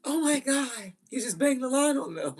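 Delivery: put-on high, loud scandalized kid voice; imitated shocked child. Child to parent who hung up, high mock shock. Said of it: "Your child picks that up".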